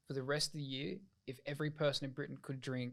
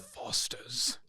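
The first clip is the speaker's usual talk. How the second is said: whisper. Real, not acted. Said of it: "Yeah, Foster's".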